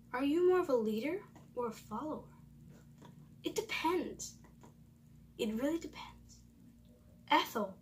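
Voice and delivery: ordinary talk, nothing marked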